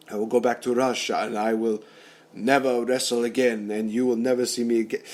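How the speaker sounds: badly faked Russian accent; hammed-up Russian accent vow; poor Russian accent. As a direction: do a rough imitation of a Russian wrestler's accent. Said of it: "that's a poor Russian accent".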